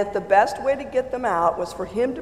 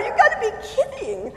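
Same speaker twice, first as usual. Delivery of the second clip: laughing disbelief. Retold or imitated. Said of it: "I'm like".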